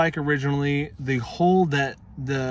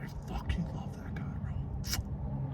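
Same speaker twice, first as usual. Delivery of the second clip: whispered fond aside; breathy whispered repeat; 13 dB quieter, slower than usual. Shown as a demonstration. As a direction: whisper it like a secret. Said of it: "repeated as whisper".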